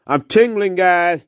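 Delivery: high-pitched put-on voice; comic high echo. Guide echoing the tingling catchphrase to viewers, high voice. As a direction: repeat it as the high sidekick.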